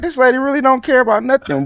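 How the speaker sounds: incredulous retold thought. Retold own young thought.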